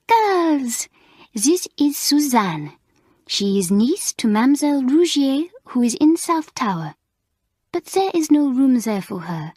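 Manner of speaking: put-on high, excitable French teacher voice with a French accent; bubbly French mistress, lively French accent; French accent Mamselle.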